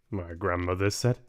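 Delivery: ordinary delivery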